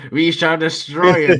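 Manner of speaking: booming evil overlord declaring conquest; grand sinister villain. Pretend. Villain plan banter.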